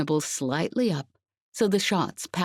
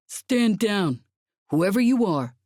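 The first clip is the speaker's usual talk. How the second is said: higher and louder, as if over a loudspeaker; a commander booming through a megaphone; amplified voice; 6 semitones higher, 4 dB louder than usual. A narrator's reading. Soldier's commander warning unseen attackers, loud amplified order. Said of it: "came an amplified voice from outside".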